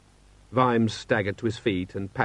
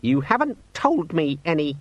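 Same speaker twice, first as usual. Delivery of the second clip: higher-pitched, petulant complaint; sulky demon; 6 semitones higher than usual. A narrator's reading. Demon blaming its owner, sulkily. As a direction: grumble squeakily at being neglected. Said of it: "said the demon sulkily".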